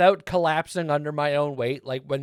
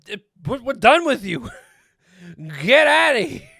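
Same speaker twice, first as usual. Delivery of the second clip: mock outraged shout; 9 semitones higher, 9 dB louder than usual. Surprised by a game character.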